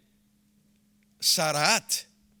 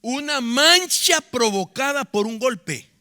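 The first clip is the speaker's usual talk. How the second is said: emphatic preaching; 6 semitones higher, 6 dB louder, slower than usual. Real, not acted.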